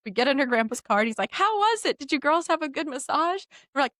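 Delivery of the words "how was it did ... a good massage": higher, cheery asking voice; a hint of an oblivious grandpa, pitched up; lightly voiced grandpa. Grandpa greets girls after massage, lightly voiced question.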